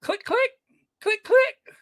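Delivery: high, quick mock camera clicks; camera click imitation. Mocking him, imitating a camera's clicks in a high voice. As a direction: chirp the clicks like a shutter going off.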